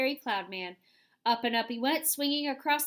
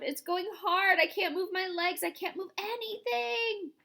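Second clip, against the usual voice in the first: panicked whine; 8 semitones higher than usual. A narrator's reading. Centipede panicking.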